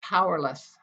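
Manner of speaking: measured, expressive poetry reading voice; wry, deliberate poem recital. Acted. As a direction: read it like a poet at a reading. Reads her poem aloud.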